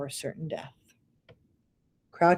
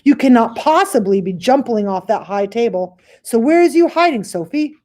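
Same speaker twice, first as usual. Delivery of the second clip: loud, high booming giant calling out; giant's voice; 5 semitones higher, 7 dB louder than usual. Acted. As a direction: bellow for the hiding girl. Giant searching for hidden Sophie.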